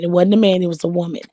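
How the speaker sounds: ordinary talk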